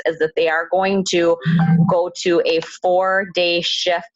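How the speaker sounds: usual speaking voice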